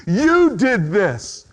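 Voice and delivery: slow, high, accusing; mild acted accusation; acted blame. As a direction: point the finger slowly. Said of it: "You're mad at someone else".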